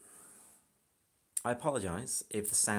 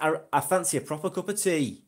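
hammed-up Yorkshire example for a friend to copy; Yorkshire accent; 6 semitones higher, 5 dB louder than usual. Shown as a demonstration. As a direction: demonstrate a broad Yorkshire accent, pitched up and louder. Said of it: "can you do a Yorkshire accent".

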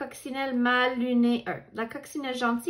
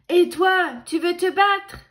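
louder, higher picking a fight; loud high challenge; 6 semitones higher, 7 dB louder than usual. A narrator's reading. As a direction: shout the dare up high. Grumpy ladybug challenges warbler.

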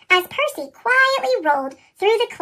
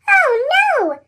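high, louder gasp of alarm; sudden realisation, pitched up in worry; a startled little cry; alarmed peep; 8 semitones higher, 4 dB louder than usual. A narrator's reading. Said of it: "Thomas peeped".

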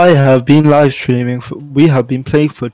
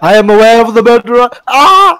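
sudden startled yelp, higher and louder; 10 semitones higher, 9 dB louder than usual. Real, not acted. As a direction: jolt up in surprise. Sudden scare in game.

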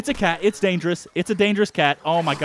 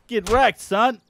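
loud cocky gloat, higher pitched; gloating taunt; 6 semitones higher, 3 dB louder, slower than usual. Pretend. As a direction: rub the win in his face. Gloating after a kill.

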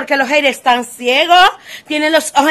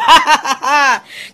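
laughing. Real, not acted. Laughs at her own joke.

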